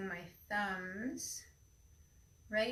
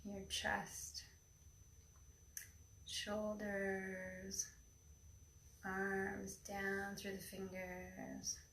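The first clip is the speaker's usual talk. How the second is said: airy breathy mystical healer; 9 dB quieter than usual. Acted. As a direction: breathe each word into calm stillness. Leads a guided meditation.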